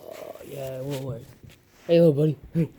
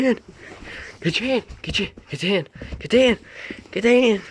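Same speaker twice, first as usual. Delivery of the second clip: playful excitement; 7 semitones higher, 3 dB louder than usual. Real, not acted. Teasing puppy to bite hand.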